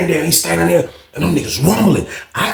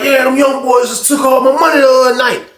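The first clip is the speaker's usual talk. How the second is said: higher, louder, riled-up griping; loud complaint; 10 semitones higher, 4 dB louder than usual. Retold or imitated. Johnson complaining loudly to the big dude about losing money. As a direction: get loud and worked up about the lost money. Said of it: "he keep getting all loud".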